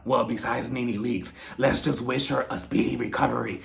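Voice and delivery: low, hushed parody of a prayer; mock prayer. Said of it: "she gives a little prayer".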